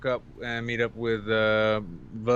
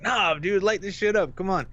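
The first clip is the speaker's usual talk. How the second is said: higher, brighter mock dude; imitating laid-back guy; 7 semitones higher than usual. Coastguard guy urging the kid to smoke, mock dude voice. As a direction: egg him on lightly.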